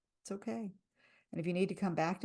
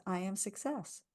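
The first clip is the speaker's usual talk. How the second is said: soft, even tapping affirmation; calm affirmation; 5 dB quieter than usual. Shown as a demonstration. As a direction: say it softly for them to repeat. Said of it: "repeat after me and listen".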